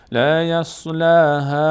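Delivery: "یصلاها" chanted with the final aa greatly drawn out; long madd. Shown as a demonstration. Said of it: "یک مد هم هست باید بکشیم".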